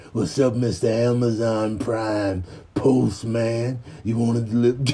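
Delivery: low, slightly soft taunt; hammed-up low menace; mock tough guy. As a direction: needle the postman, low and slow. Voices menacing the postman.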